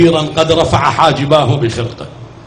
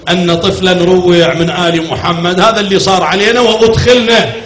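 loud, raised lament preaching; full-force sermon recitation; 3 semitones higher, 3 dB louder than usual. Acted. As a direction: drive the mourning story to the crowd.

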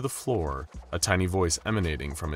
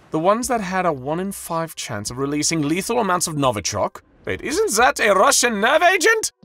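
high, loud horrified cry; horrified exclaim; 14 semitones higher, 9 dB louder than usual. A narrator's reading. Executive realizing the bear is deadly, high and loud in horror. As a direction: shriek in alarm. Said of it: "another exclaimed in horror".

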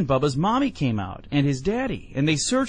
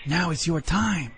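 ordinary delivery, nothing marked

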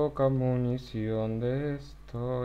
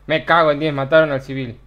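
loud frustrated swearing; swearing when the civilian dies; angry outburst; 11 dB louder, faster than usual. Real, not acted. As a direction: curse it out loud.

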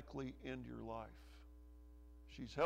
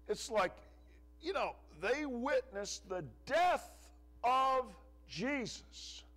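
louder, higher, slowed preaching build; rising preaching; 8 semitones higher, 7 dB louder, slower than usual. Acted. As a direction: lean into the sermon, stretching the words out. Preacher builds to full force.

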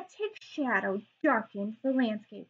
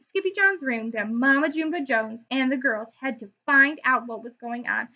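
usual speaking voice

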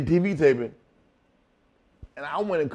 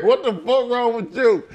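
high, loud mock outrage; retold indignant outburst; incredulous retort; 9 semitones higher, 6 dB louder than usual. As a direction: call him out in disbelief. Acted indignant reply to promoter.

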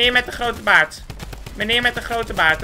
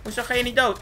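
ordinary talk, nothing marked

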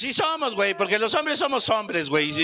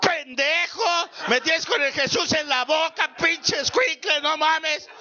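higher, slow yelled scolding; furious mother; 6 semitones higher, slower than usual. Retold or imitated. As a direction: bawl the kid out, dragging the words. Mother scolding lost child.

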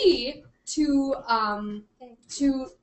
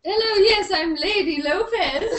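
high, drawn-out mock English accent; hammed-up English lady, slowing into the name; 6 semitones higher, 6 dB louder than usual. Introducing herself as a lady at tea, put-on English accent. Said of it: "gonna do an English accent".

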